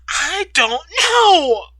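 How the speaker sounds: slow, loud crying words; bawling. Acted. Katie breaking down before Ryder, bawling. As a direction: sob it out, slow. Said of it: "She starts bawling".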